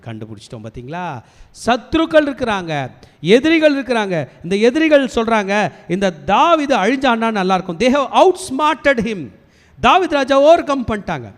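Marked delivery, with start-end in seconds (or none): 1.7-11.3 loud, raised-pitch sermon delivery; forceful preaching. Real, not acted. Preacher telling how enemies outsmarted king David, forceful preaching. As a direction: drive each phrase home, high and loud.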